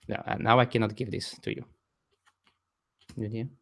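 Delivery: ordinary talk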